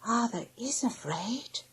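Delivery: put-on high, soft, slow child voice; hushed little observation in a small high voice. A narrator's reading. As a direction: say it slowly under the breath. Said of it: "said the youngest child more quietly".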